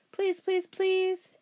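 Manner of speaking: put-on high, loud pleading kid; a boy crying out to his parents to keep the name Dracula; begging cry. A narrator's reading. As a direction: beg Mom and Dad at the top of your voice. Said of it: "with each please, he squeezed".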